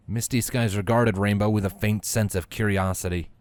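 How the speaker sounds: ordinary delivery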